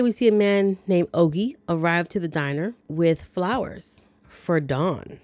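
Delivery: usual speaking voice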